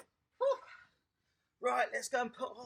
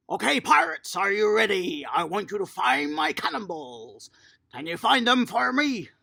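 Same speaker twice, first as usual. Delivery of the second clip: loud, lively captain calling the crew; rally the little pirates like a captain; a playful pirate captain rallying kids; pirate captain game call; 12 dB louder than usual. Acted. Coach calls pirate game to kids.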